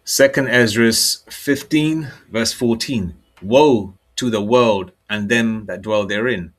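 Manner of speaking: loud, slow, declaiming verse; scripture reading. Acted. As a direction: proclaim the verse to the congregation, slowly. Announces and reads scripture.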